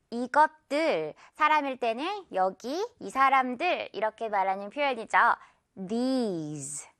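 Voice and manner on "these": These with the "ee" drawn out, low and soft; "These" low, long ee. Shown as a demonstration. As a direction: stretch the vowel in These with lips pulled sideways. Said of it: "양옆으로 찢어서 끌어주시면서".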